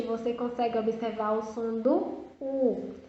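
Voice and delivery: ordinary talk, nothing marked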